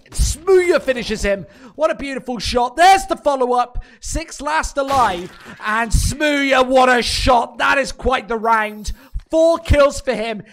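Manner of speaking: loud, high hype call of the big play; excited shout. Real, not acted. Caster hyping Smooya's four-kill round, excited shout. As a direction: roar it as the shots land.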